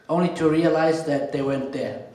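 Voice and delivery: ordinary delivery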